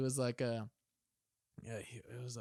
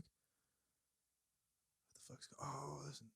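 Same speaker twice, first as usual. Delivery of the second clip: retold whisper from bed; very soft, halting reaction; whispered bewilderment; 14 dB quieter, faster than usual. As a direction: whisper to yourself, trying not to be noticed. Himself in bed overhearing at night.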